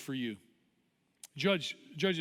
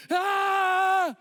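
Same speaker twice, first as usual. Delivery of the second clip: long, high, loud cheering scream; wild cheer; 14 semitones higher, 9 dB louder than usual. Retold or imitated. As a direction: let out a sustained whoop of a yell. Cheerleader's yell acted out.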